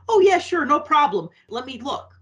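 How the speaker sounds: mock cheery but flustered phone lady; bright, high, covering panic; panicky receptionist. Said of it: "I could just hear the panic in her voice".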